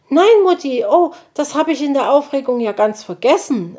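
hohe Kinderstimme, eifrig; kleiner Igeljunge. Acted. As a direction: ein aufgeregter kleiner Junge entschuldigt sich. Borstel ruft überrascht. (Borstel entschuldigt sich eifrig bei Mutti)

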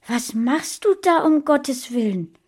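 high, loud, shocked question; alarmed. A narrator's reading. Friend finding the boy locked up, alarmed. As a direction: demand it in horrified alarm. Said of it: "rief sie erschrocken".

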